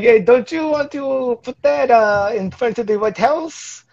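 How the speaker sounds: sweet-talking the president, drawn out; mock France pitching. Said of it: "France was like".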